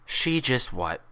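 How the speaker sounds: cool nurse voice with hidden contempt; polite words, icy underneath; professional tone, contempt. A narrator's reading. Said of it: "her professional tone not matching the contempt".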